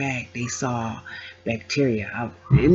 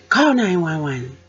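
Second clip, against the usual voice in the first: urgent plea; 9 semitones higher than usual. Retold or imitated. Begging husband while passing out.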